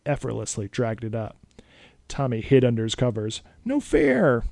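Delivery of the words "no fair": put-on very high, loud kid's cry; a cheated kid wailing; whiny protest. A narrator's reading. Boy protesting the vampire's trick. Tommy hid under covers.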